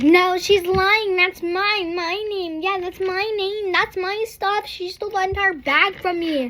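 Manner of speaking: put-on high, whiny little-girl protest; bratty kid insisting. Little girl claiming the bag.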